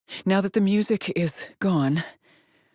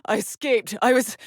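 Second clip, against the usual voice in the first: higher-pitched, breathless alarm; frantic disbelief at being back; panicked; 4 semitones higher than usual. Acted. Trapped woman realising her escape failed, panicked.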